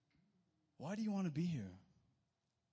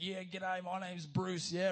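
higher-pitched Australian greeting from a swaggering shark; mock Australian shark; 4 semitones higher, 5 dB louder than usual. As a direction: play a shark with an Australian accent. Playing Bruce, the g'day shark.

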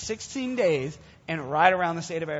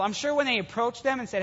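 ordinary talk

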